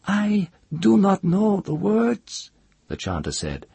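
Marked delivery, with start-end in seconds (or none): 0.1-2.5 high, slow and terrified. A narrator's reading. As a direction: plead in rising terror. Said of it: "his voice rose high as if in terror".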